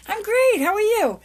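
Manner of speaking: bright, high, beaming reply; cheerful I'm great. Retold or imitated. Answering a friend's greeting, beaming and high-pitched. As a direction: chirp it out like the best day ever. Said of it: "I say I'm great all the time".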